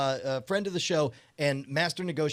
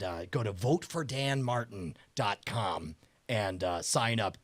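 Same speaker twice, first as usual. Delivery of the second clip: Dan Martin impression; 7 semitones lower, 5 dB quieter than usual. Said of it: "this is me doing my Dan Martin impression".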